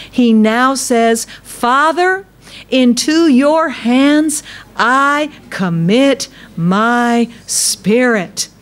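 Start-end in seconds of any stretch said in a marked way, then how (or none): 1.4-8.5 high, slow prayer of surrender; voicing Jesus' prayer. Preacher voices dying Jesus committing his spirit, high and slow. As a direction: lift up and slow right down.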